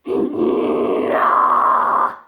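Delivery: loud rasping throat clear; rough throat clear. Shown as a demonstration. Demonstrating false chords, rough throat clear. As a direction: scrape the throat. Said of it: "clearing your throat".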